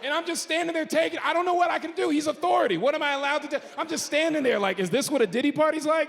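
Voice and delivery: high-pitched comic distress; helpless bewilderment. Retold or imitated. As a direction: plead in mock panic, pitch held high. Helpless bewilderment during the retold pat-down.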